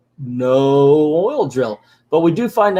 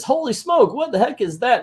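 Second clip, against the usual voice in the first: much higher mock alarm; startled bad guy; 10 semitones higher than usual. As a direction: cry out like a flustered cartoon villain. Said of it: "the bad guy says".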